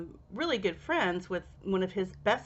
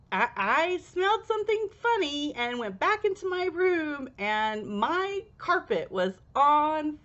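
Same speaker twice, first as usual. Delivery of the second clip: friend insisting; 6 semitones higher than usual. Retold or imitated. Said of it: "He's like".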